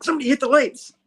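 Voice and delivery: high, loud crook voice; lightly hammed-up henchman yelling; crook's panicked call. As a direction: squeal it out like a startled thug. Crook voiced in comic retelling.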